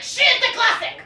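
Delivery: indignant dad insisting it's a classic; dad defending the movie. Retold or imitated. Comic retelling her dad's protest, mock indignant. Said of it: "my dad's like".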